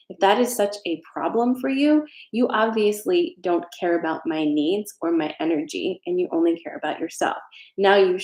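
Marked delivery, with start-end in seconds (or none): none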